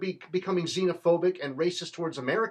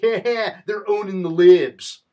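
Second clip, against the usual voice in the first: loud, slow, smug put-on voice; mock gloating boast; 3 semitones higher, 10 dB louder, much slower than usual. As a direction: sneer it out like a proud troll. Mocking a gloating partisan.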